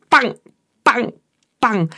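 high, punchy bangs, easing off; gunshot noises. A narrator's reading. Narrator voices shots at the sprinting friends, punchy bangs. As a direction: fire off three separate imitated shots. Imitated gunshots during the run.

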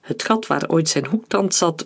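usual speaking voice

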